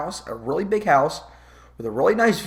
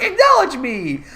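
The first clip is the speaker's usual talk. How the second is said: put-on laughing kid voice; 10 semitones higher, 4 dB louder, slower than usual. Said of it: "He's like".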